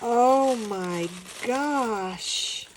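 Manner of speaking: gasp, then a slow drawn-out oh my gosh; gasp of delight. Real, not acted. Opening a gift package, gasp then slow awed oh my gosh. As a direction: gasp and stretch the words in awe.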